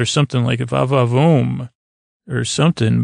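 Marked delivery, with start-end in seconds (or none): 0.6-1.7 mock leering. Geordi's salacious comment on hunk Wesley.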